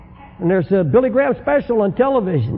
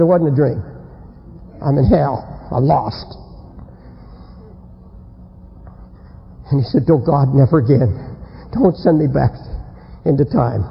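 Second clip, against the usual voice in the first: low, halting despair; 6 semitones lower than usual. Acted. Man realising he is back in hell, despairing. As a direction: let the hope drain away, pitched low.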